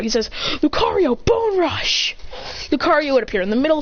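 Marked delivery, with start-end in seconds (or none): 0.6-2.2 high-pitched called-out move; attack command. Acted. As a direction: call the attack across the field. Trainer shouts attack command.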